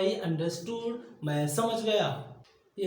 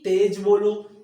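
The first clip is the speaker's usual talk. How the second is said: the loud version, not the fast one; loud command as the wrong reading; said loudly; 10 dB louder, slower than usual. Shown as a demonstration. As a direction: call it out loud. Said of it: "तेज बोलो का मतलब ये नहीं है कि आप आवाज़ ज़्यादा करो".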